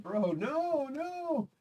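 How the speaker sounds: high, pleading "no, no" in real shock; stunned disbelief. Real, not acted. Telling viewers about the brain scan, real disbelieving no. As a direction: cry out against the news.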